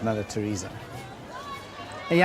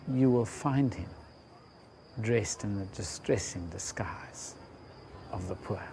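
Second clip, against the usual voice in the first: softer, almost whispered; hushed repeat; 3 semitones lower, 7 dB quieter than usual. Shown as a demonstration. Preacher repeating the key line, hushed and reverent. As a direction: drop to a reverent hush on the repeat.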